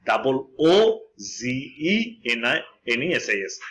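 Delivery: spelling it out for the class; slow spelling out. Shown as a demonstration. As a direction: spell double ও জি ই এন slowly, letter by letter. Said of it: "দীর্ঘ উ দিয়ে সেই উচ্চারণটা করব".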